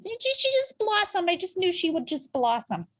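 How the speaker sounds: very high, gushing great-aunt voice; high-pitched doting aunt. Acted. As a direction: gush like a delighted great aunt. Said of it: "like my great aunt".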